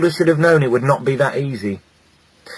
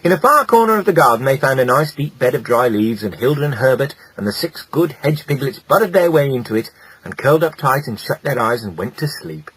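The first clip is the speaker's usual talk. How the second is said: ordinary delivery, nothing marked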